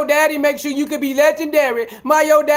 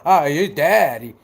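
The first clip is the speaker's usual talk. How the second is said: mock complaining critic; 9 semitones lower than usual. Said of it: "no motherfucker talking about".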